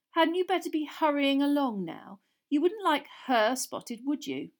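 Malfoy goading Hermione; sly sneer. A narrator's reading. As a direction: taunt with mock concern. Malfoy taunting.

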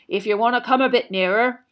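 big booming hearty giant; a jolly loud gamekeeper; Hagrid voice. A narrator's reading.

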